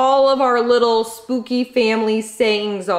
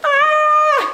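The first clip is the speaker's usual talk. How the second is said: very high, loud held squeal; piercing "ah" of real excitement; excited squeal; 6 dB louder than usual. Real, not acted. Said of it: "I'm so excited".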